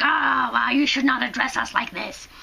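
ordinary delivery

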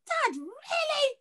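high, slow disbelieving daughter; high slow disbelief. Retold or imitated. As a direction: stretch it out in disbelief. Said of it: "I was like".

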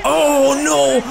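shocked outcry. Real, not acted. Reacts to a hard hit.

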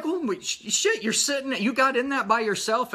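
high, loud, amazed disbelief; retelling his shock at seeing Chad in the sidecar; astonished. Retold or imitated. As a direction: gasp it out like you can't believe your eyes. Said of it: "I'm like".

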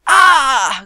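sharp loud scream of pain. Acted. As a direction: cry out as if stabbed. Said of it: "Grité".

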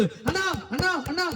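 high, urgent plea to brother; acted tearful calling. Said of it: "ఏడుస్తూ". (ఏడుస్తూ పిలుస్తున్నట్టు, ఎత్తైన గొంతుతో)

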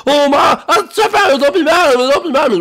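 loud, high, hurried pleading; panicked pleading. Acted. Boy pleading with angry chef, loud and panicky. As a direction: beg loudly not to be blamed. Junior pleads after outburst.

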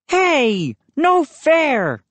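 high-pitched objection; protesting declaration. A narrator's reading. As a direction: protest like a cheated boy. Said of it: "he declared".

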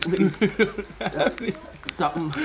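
loud, laughing through the burn; desperate pleading, mouth on fire; mouth burning from spicy food. Real, not acted. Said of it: "This is so hot".

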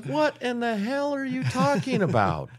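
high-pitched mock disbelief; incredulous husband. Husband answering wife's affair accusation, mock incredulous. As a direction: protest in exaggerated bewilderment.

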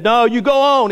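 high, insistent brush-off; mock-stubborn old man; acted stubborn refusal. Old man waving off rescuer.

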